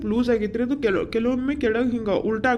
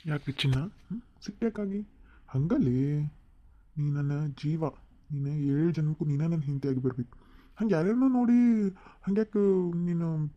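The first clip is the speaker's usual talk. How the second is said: tender coaxing; 2 semitones lower, 6 dB quieter than usual. Acted. Husband sweet-talking his upset wife, soft and tender. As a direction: soothe a sulking sweetheart gently.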